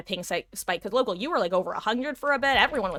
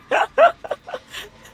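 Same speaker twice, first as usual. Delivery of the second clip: high laugh; 11 dB louder than usual. Real, not acted. Laughing after her own singing.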